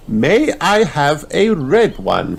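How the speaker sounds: high, slow polite teacher; gentle lilting request; Madame Gazelle voice. A narrator's reading. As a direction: ask it sweetly and slowly. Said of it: "says Madame Gazelle".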